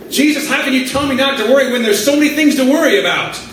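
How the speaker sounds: acted-out worried complaint to Jesus; exasperated mock protest. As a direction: plead your case in one quick rush.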